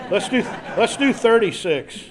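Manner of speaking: amused self-mocking laugh; laughing at himself. Real, not acted. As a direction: laugh it off as he fumbles the verse. Fumbling for the right verse.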